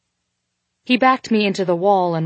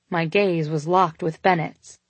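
ordinary delivery, nothing marked

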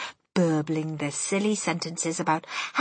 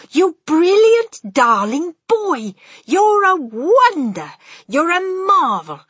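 delighted praise; 9 semitones higher, 9 dB louder, slower than usual. A narrator's reading. Grandmother praising the boy.

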